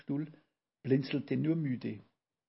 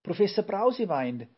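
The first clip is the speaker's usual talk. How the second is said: high, loud startled call; startled cry; 7 semitones higher, 5 dB louder than usual. A narrator's reading. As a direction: cry out in shock. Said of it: "riefen sie erschrocken".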